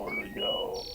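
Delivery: very high, thin squeaky repeat; sudden falsetto question; silly squeak. Pretend. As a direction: squeak the question like a cartoon mouse.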